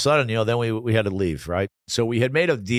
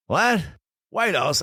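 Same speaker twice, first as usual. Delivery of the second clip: retold shock; 10 semitones higher, slower than usual.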